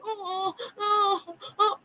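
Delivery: high, soft mock wailing; hammed-up little sobbing oh's; mock crying wail. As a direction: imitate a weepy woman, half-joking. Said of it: "And it's more like".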